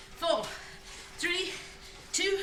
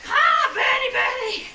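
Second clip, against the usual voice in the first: straining shout; 14 semitones higher, 9 dB louder, slower than usual. Real, not acted. Strained yell during final sprint.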